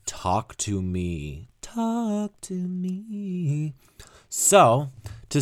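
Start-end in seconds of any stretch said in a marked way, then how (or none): 0.1-1.5 P=A; slow title announcement. Shown as a demonstration. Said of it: "the next track would be". 1.6-3.7 P=A; drawn-out high title. Shown as a demonstration. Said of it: "the next track would be".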